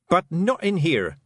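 high, slow, cross objection; mildly angry telling-off; snapped angrily. A narrator's reading. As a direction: snap it with contained anger. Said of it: "snapped Tiffany, shaking with anger".

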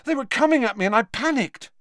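high, fast, breathless panic; panicked, panting. A narrator's reading. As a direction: blurt it out frightened. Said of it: "panted Harry".

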